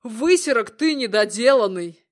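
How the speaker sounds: loud furious insult; furious shout. Acted.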